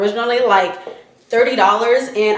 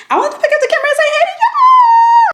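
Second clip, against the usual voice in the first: very high, fast, louder squeal; squealy greeting; 5 dB louder, faster than usual. Real, not acted. Lifting the camera to greet viewers, very high squeal. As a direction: squeak it out excitedly.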